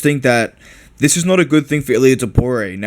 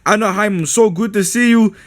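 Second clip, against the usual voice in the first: higher, lightly voiced excited fighter; mock Merab; 8 semitones higher than usual. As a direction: hype up the crowd like a grinning fighter. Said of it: "Merab says".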